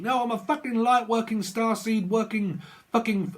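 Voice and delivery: high-pitched mocking spiritual boaster; self-styled lightworker. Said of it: "they start saying that they're".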